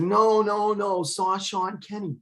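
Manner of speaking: high, insistent no no no; urgently insisting, voice up high; agitated. Retold or imitated.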